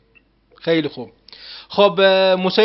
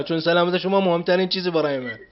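ordinary talk

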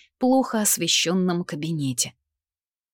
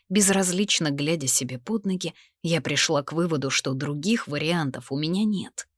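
ordinary delivery

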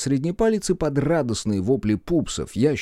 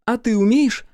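much higher and louder, a quick retort; snapping back; 13 semitones higher, 5 dB louder than usual. A narrator's reading. Olli, fallen over, snaps back at the mocking Ululul. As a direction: bite back at the mockery. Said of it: "огрызнулась Олли".

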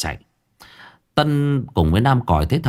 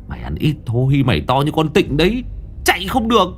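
grumbling scold; 7 semitones higher than usual. A narrator's reading. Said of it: "vừa lầu bầu chửi".

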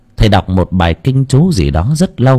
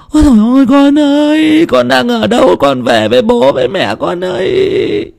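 sobbing wail. Acted. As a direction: cry out for the lost child. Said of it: "khóc nấc cả lên".

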